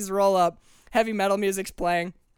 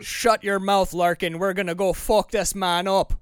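Scottish accent slipping through bad American; badly hidden Scottish accent; Scotsman faking American; 2 semitones higher, 4 dB louder than usual. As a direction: play a Scot failing to sound American. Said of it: "like a Scotsman trying to sound like an American".

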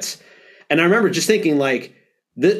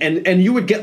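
usual speaking voice

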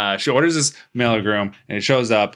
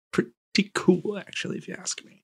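hushed aside; 8 semitones higher, 6 dB quieter than usual. Real, not acted. Aside about one-take scene.

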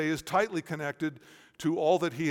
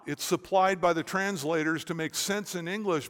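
ordinary talk

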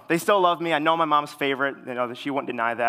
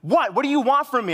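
high, loud exasperated outburst; mock sisterly snap, pitched way up; imitated angry yell; 11 semitones higher, 6 dB louder than usual. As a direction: yell like a sister who's had enough. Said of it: "yell at me and say".

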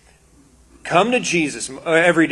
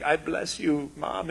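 tender blessing; 8 dB quieter, slower than usual. Retold or imitated. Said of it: "he'd just be like".

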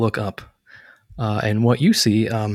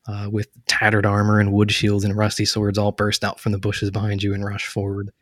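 ordinary delivery

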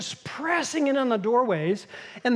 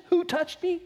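higher, mocking disbelief at the question; incredulous echo; 8 semitones higher than usual. Disciples echoing Jesus in the crowd, incredulous. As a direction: repeat it as if it's absurd. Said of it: "And the disciples go".

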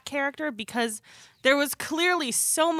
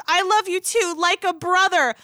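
mock quote; 7 semitones higher, 3 dB louder than usual. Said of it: "She goes".

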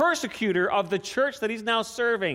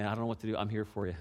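gentle sincere offer; 10 semitones lower, 12 dB quieter, faster than usual. Retold or imitated. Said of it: "But coming and saying".

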